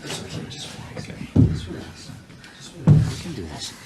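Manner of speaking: soft, hushed calming whisper; soothing whisper. Acted. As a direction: whisper her down from panic. Husband whispers to panicking wife.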